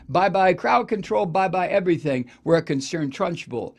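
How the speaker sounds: usual speaking voice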